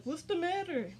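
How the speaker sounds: high-pitched mock question; imitated concerned partner. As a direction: act the partner walking in on tears. Child's father walks in, asks.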